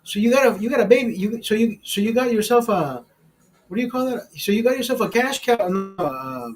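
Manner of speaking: teasing buddy. Retold or imitated. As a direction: rib the guy about his sugar mama. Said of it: "He says".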